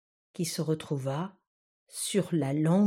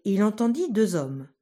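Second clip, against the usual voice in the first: usual speaking voice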